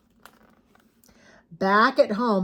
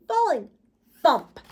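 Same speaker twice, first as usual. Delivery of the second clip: ordinary delivery, nothing marked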